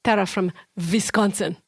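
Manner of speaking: mock-Russian sign-off; playful Russian accent. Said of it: "there were so many W's in there".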